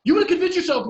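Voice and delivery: high, loud scornful retort; shouted no. Real, not acted. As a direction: shoot her down with a yell. Ranting, contradicting the quoted woman.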